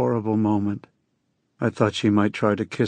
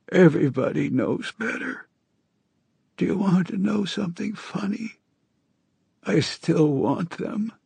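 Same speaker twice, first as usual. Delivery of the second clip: hoarse jackdaw voice; 8 semitones higher than usual. A narrator's reading. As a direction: rasp it out like a jackdaw. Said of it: "in that hoarse jackdaw voice".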